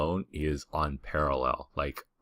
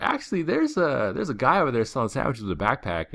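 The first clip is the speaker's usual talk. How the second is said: much higher, bright, helpful voice; helpful tip-off; 9 semitones higher, 4 dB louder than usual. Retold or imitated. Pointing two partygoers to the sandwich seller, cheerfully helpful.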